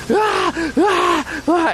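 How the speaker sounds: high strained yells, hammed-up; mock yelling man. Retelling the neighbor's 3 AM yelling, mock shout. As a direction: belt out the neighbor's wordless yelling. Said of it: "this guy was like".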